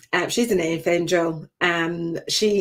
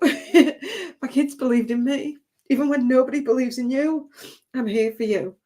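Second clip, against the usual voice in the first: laughing through tears; 6 semitones higher than usual. Real, not acted. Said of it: "I'm gonna try not to cry".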